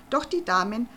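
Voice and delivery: ordinary delivery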